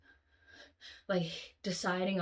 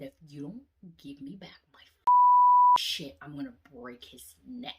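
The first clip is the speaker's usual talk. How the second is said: menacing threat; 4 semitones higher, 12 dB louder than usual. Retold or imitated. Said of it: "Like, super serious".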